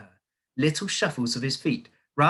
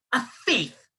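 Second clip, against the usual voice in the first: much higher shrieked accusation; screamed accusation; 13 semitones higher, 4 dB louder than usual. A narrator's reading. Headmistress accusing boy, a high scream. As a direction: scream the charge out. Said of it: "the Trunchbull screamed".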